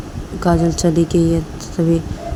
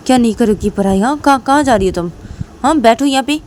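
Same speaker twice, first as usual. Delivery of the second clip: raised, higher-pitched scolding; stern demand; 6 semitones higher, 3 dB louder than usual. Acted. Reshma grabbing Kajal as she walks out, stern scolding. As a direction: order them back with rising indignation.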